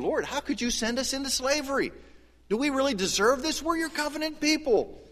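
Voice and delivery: high, pleading whine of exiles; whiny complaint. Retold or imitated. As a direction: complain to God like a wronged child.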